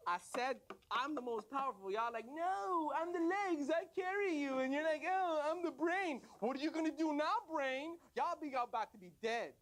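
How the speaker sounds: high-pitched taunting mimicry; mocking tirade. Tongue throwing the body parts' boasts back, mocking tirade.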